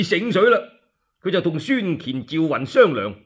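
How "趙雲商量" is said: plain reading voice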